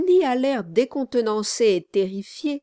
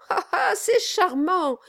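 high, put-on "ah, ah" laugh; regaining composure; 8 semitones higher than usual. A narrator's reading. Lawyer flatters the dwarf, regaining composure with forced delight. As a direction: exclaim it with pitched-up delight. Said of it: "reprenant un peu d'aplomb".

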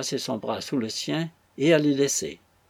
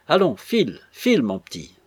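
angry, loud order to clear off; ordre rageur; 3 semitones higher, 6 dB louder than usual. A narrator's reading. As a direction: snap it out, loud and high on the first words. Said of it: "en grinçant des dents". (ordre sec et rageur)

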